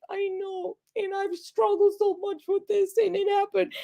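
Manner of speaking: high, tearful squeak, re-enacted; crying. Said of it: "I just started crying".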